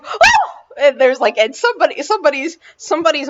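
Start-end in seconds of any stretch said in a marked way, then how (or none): 0.0-0.5 very high hammed-up gasp; mock gasp. Rich folks hear the cannibal accusation, hammed-up high gasp. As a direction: squeal a scandalized gasp. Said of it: "and the gasp is like".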